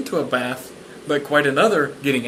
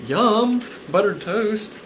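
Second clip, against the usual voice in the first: delighted; 6 semitones higher, much slower than usual. Real, not acted. Reader delights in toast.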